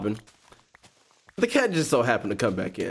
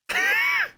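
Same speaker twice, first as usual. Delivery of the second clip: high laugh. Real, not acted. Vlogger laughing after mimicking her, high squeal.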